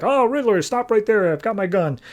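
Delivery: high-pitched mock guard ordering a halt; alarmed guard challenge. Guard stops Riddler at gunpoint, mock alarm. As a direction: call out in alarm, easing down by the end.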